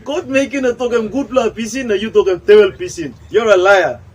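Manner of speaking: loud accusing shout; acted accusation. To his brother in the Genesis skit, a loud accusing shout. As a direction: yell it like a brother caught lying.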